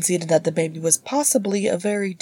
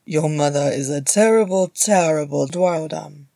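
solemn dwarf, louder and stretched out; mock solemn; 5 dB louder than usual. A narrator's reading. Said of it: "Gimli said solemnly".

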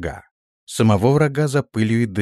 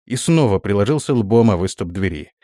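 ordinary delivery, nothing marked

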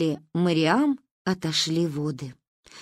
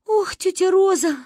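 high, loud tearful cry; 11 semitones higher, 3 dB louder than usual. A narrator's reading. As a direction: wail the name in tears. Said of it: "заплакала Кристина". (плачущий возглас)

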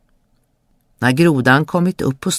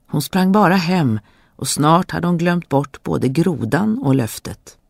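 ordinary delivery, nothing marked

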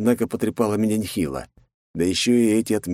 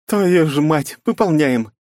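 higher, resigned curse; doomed Night; 9 semitones higher, 5 dB louder, slower than usual. A narrator's reading. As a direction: swear then accept it. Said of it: "обречённо ответила Найт".

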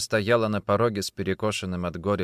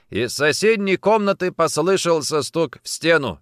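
usual speaking voice

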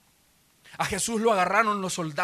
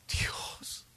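breathless whisper of a dying man; a whispered cry to God; anguished whisper; 10 dB quieter than usual. Acted. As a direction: whisper God's name in agony. Voicing Jesus on the cross.

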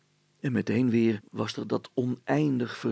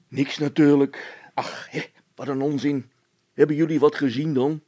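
louder, dismissive policeman; a policeman brushing it off; gruff officer; 5 semitones higher, 8 dB louder than usual. A narrator's reading. (stoere veldwachter, wuift het weg)

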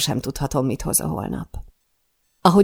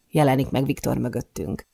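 ordinary delivery, nothing marked

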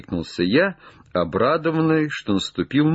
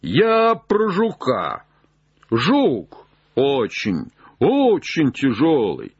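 calling down; 5 semitones higher than usual. Acted. Jupiter grumbles to Legrand about the beetle, high call. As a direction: stretch the words out from up high.

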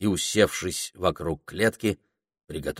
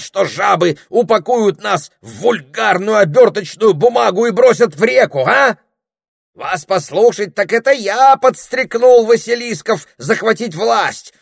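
high, loud, building rant; squawking fury at the snake; furious tirade; 14 semitones higher, 8 dB louder than usual. Acted. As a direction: pile on the insults louder and louder. Parrot's escalating furious tirade.

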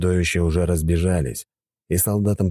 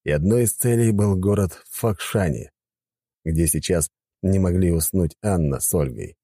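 usual speaking voice

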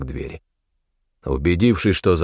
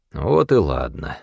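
slow, low, satisfied approval; unhurried, contented acceptance; 6 semitones lower, slower than usual. A narrator's reading. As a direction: let it settle with contentment. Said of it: "удовлетворённо кивнул Палыч".

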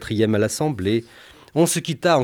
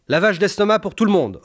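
ordering; 8 semitones higher than usual. Acted. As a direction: bark the order to everyone. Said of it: "ordonna le médecin". (ordre sonore du médecin)

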